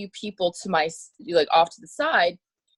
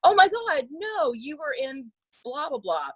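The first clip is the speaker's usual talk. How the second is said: excited fan; 8 semitones higher than usual. Retold or imitated. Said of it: "someone goes".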